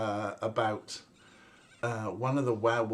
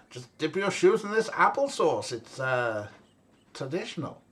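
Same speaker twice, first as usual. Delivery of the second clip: hammed-up bad German accent, pitched higher; 4 semitones higher, 4 dB louder than usual. Joking to viewers about German customs in a bad German accent. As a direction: put on a silly mock German accent. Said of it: "an excuse for me to do some bad German accents".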